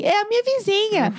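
imitating a child's high, carrying announcement; very loud child. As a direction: announce it high, like an unfiltered kid. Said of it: "Tipo, muito alta". (imitando criança falando muito alto, com voz aguda)

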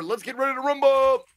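high, sustained arena call; hammed-up boxing announcer shout; ring announcer. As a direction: hold the last word long.

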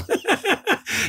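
real high laugh bursting out; high burst of laughter. Real, not acted. Laughing at his friend's joke, high burst of laughter.

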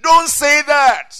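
slow, high, very loud command; forceful admonition. Real, not acted. Preacher warning the congregation about their words, forceful admonition. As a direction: shout it as a warning.

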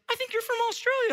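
put-on very high-pitched child voice; imitated kid. One kid guesses the teacher is Australian, imitated kid. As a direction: pipe up like a little kid, way up high. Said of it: "one kid goes".

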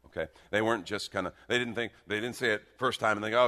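ordinary talk, nothing marked

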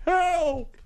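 mock dying plea. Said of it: "That's his text message".

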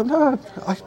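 high-pitched insistent correction; excitedly retold rebuttal; retold protest. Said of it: "I go".

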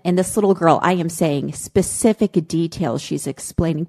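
normal talking voice

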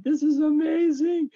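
high-pitched, thrilled retold yell; re-enacted ecstatic outburst; excited yelling. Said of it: "I start yelling. I'm so excited".

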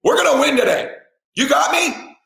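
high, urgent shouting at the son; intense, strained rallying cry; fired-up pep talk. Retold or imitated. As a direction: yell it like a coach rallying a player. Dad firing up his scared son.

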